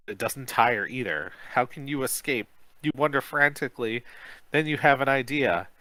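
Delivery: ordinary delivery, nothing marked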